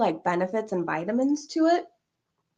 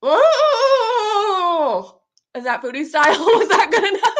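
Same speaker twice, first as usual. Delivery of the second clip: laughing; 11 semitones higher, 7 dB louder, slower than usual. Real, not acted. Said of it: "that was my best impression".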